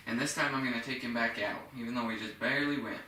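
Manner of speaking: ordinary delivery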